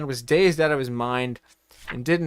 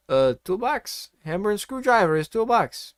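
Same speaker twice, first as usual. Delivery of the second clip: confused broken English; 6 semitones higher than usual. A narrator's reading. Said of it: "not really understanding the question".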